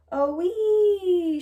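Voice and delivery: put-on a child squealing at floating seeds; high excited squeal; squealing with delight. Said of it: "she squealed".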